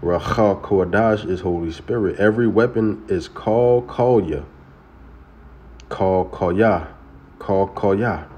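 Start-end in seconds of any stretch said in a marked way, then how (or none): 2.2-8.3 "Kal Kolya" as kol kolya, slow and falling; Hebrew pronunciation. Shown as a demonstration. Preacher teaching viewers to say "Kal Kolya" as kol kol-ya. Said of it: "That's how you say every weapon".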